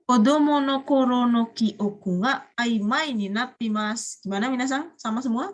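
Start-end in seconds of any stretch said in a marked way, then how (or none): 0.0-4.2 slow, clear teacher reading; slow model reading for students. Shown as a demonstration. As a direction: read the sentence slowly as a model for the class. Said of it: "model reading of student's line".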